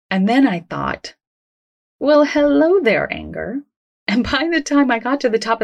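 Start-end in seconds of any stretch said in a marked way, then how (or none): 2.0-3.6 playful greeting. Retold or imitated. Walker acknowledging their own anger, playful slow greeting. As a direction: greet it like an unexpected visitor, drawn out.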